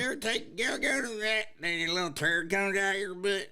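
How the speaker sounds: high, loud hillbilly dog voice. Acted. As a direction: call out as a backwoods hound. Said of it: "Now your dog talk like this".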